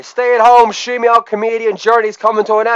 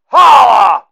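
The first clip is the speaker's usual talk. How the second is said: shouted catchphrase; 4 dB louder than usual. Acted. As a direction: belt out holla, pitch falling. Catchphrase shout after joke.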